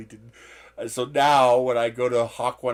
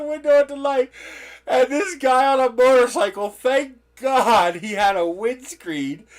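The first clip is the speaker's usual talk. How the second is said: laughing while telling; 11 semitones higher, 9 dB louder than usual. Real, not acted. Retelling embarrassing story, laughing.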